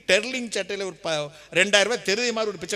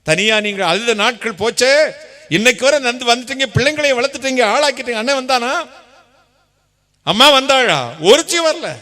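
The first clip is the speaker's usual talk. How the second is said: loud, high rhetorical preaching; fervent preaching; 5 semitones higher, 6 dB louder than usual. Acted. Preacher to congregation, loud rhetorical questions. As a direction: hammer each question home.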